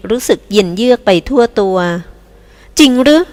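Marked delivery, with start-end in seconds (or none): 2.8-3.2 shocked. Acted. Shocked at pregnancy news.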